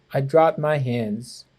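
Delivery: ordinary delivery, nothing marked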